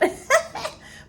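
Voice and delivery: sudden high airy laugh at her own joke; laughing burst. Real, not acted. Teasing herself about looking young, a high airy laugh.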